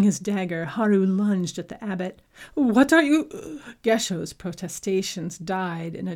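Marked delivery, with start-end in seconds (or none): 2.5-3.6 startled outcry. Acted. Abbot startled at drawn dagger.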